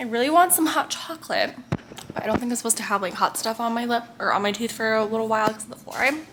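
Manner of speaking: usual speaking voice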